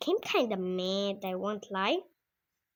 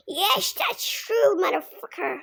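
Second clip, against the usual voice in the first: friend voice; 9 semitones higher, 5 dB louder than usual. Retold or imitated. Best friend Tigran agreeing.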